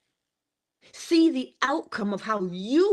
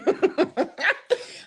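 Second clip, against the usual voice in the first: laughter; 10 semitones higher than usual. Real, not acted. Laughing at son's remark.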